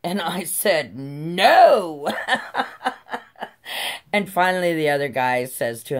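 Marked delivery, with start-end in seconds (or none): 1.0-2.1 defiant. Retold or imitated. Defiant retort to cop. 2.1-4.1 laugh. Real, not acted. Laugh after story beat.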